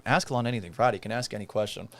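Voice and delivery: ordinary talk, nothing marked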